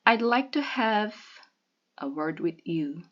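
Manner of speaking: pause, then deliberate weight on "word"; stress on "word". A narrator's reading. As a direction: set "word" apart after a pause, pointedly. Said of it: "she emphasized the word".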